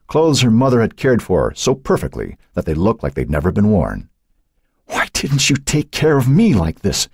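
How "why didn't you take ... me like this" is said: high, choked outcry; anguished cry. A narrator's reading. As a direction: cry out as if choking on sobs. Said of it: "she cried out".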